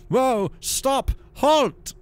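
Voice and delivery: very high, loud frantic yells; panicked shouts. Acted. Monk near the cliff edge, panicked shouting. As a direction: scream commands in panic. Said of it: "he cried, panicked".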